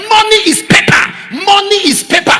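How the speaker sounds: high, loud declaration; preaching shout. Real, not acted. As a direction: proclaim it at the top of the voice. Preaching climax.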